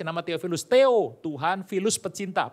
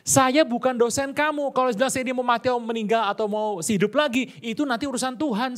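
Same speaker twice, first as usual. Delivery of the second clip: raised, higher voice telling off a lecturer; mock-stern put-down, pitched up; indignant retort; 9 semitones higher, 3 dB louder than usual. Preacher retells snapping at a student: raised indignant retort.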